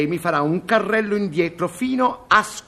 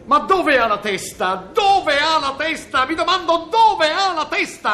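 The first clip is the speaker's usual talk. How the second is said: rising higher with each repeat, fed up; exasperated scolding; 9 semitones higher than usual. Acted. TV director scolding his cameraman, growing exasperated. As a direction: nag him harder every time you ask.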